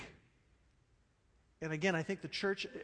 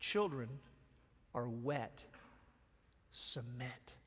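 soft, lower, earnest; gentle explaining Jesus; 6 semitones lower, 10 dB quieter, slower than usual. Retold or imitated. Jesus gently correcting the disciples, soft. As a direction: lean in and let it sink in. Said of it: "Jesus was saying".

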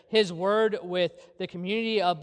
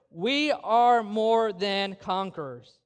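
"we are more than conquerors" stretched slowly, word by word; emphatic declaration; much slower than usual. Real, not acted. Preacher driving the verse home to the church, "we are more than conquerors" slow and emphatic. Climax, repeating the verse just read.

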